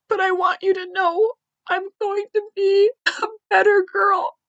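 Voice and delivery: fighting back sobs on each line; reading through tears. Real, not acted. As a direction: voice breaking high while reading a card. Reading daughter's card, breaking down.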